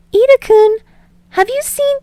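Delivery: put-on bright young woman's voice, far up in pitch; bubbly girl calling. A narrator's reading. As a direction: call down the hallway for a friend. Uraraka calling from the hallway.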